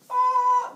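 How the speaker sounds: loud, high mock giant roar; giant's roar. Storyteller mocks gods being giants, loud roar.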